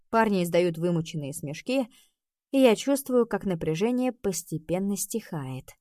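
ordinary delivery, nothing marked

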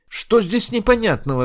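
high, loud angry snap. Acted. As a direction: snap at her in irritation. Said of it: "разозлилась Инг". (раздражённо, со злостью)

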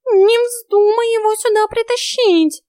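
high, loud, drawn-out cat voice; warning exclamation. A narrator's reading. Cat warns heroine off bringing a man here, high warning cry. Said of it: "предостерегающе воскликнул Васька".

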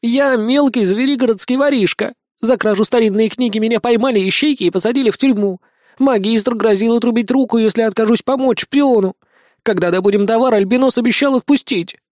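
high, whining little mouse; squeaky thief whining his story; whimpering mouse. A narrator's reading. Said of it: "хныкая и сутулясь".

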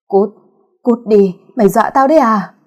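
loud panicked yell; terrified scream. Acted. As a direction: shout her off in terror. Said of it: "gào lên đầy kinh hãi". (gào lên kinh hãi)

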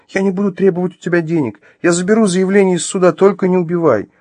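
high, loud frightened plea; tearful begging. Acted. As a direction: beg in a high whine. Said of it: "из глаз у неё покатились слёзы".

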